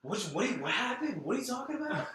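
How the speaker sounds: put-on high-pitched, dopey frat boy confusion; frat boy bewilderment. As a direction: squeak out baffled questions as a frat bro. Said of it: "he has that frat, like".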